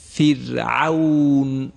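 فرعون slow, with a thin, light ra; نمونه با ترقیق. Shown as a demonstration. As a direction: stretch فرعون slowly and keep the ra thin. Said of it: "چهار تا مثال از قرآن انتخاب کردیم، میخوانم". (فرعون را آهسته و با راء نازک بخوان)

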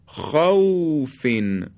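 "خوفین" with aw slowly, smoothly drawn out; soft līn. Shown as a demonstration. Teacher models waw after fatha in "خوفین", soft līn. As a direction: glide gently through the aw of "خوفین".

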